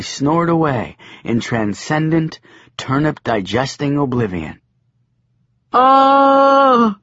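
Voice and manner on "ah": high loud yelp of disgust; shrill startled ah. A narrator's reading. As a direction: shriek ah in revulsion. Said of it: "said Joshua".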